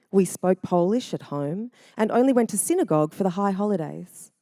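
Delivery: ordinary delivery, nothing marked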